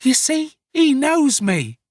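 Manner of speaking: loud, high, triumphant appeal; crying out. Acted. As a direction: cry it out high to the onlookers. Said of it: "cried Nancy".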